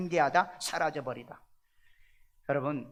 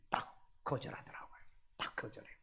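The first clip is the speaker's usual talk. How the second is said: whisper; 7 semitones lower, 16 dB quieter than usual. Real, not acted. Hushed telling of refusal.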